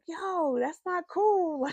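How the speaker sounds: high, loud indignant call-out; mock outrage. Reader scolding a character she wants to fight, high and loud. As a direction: scold the book character, half joking. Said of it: "fight somebody in the book and be like".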